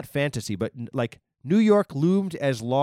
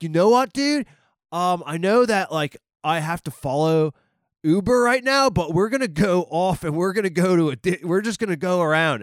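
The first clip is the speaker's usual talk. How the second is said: put-on higher, louder LA dude accent; 5 semitones higher, 4 dB louder than usual. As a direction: put on the driver's LA surfer-dude accent, pitched up. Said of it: "by his stereotypical accent".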